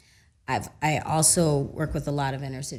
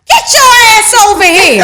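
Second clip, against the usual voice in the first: loud call; 18 dB louder than usual. Retold or imitated. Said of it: "And she was like".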